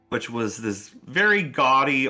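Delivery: ordinary talk, nothing marked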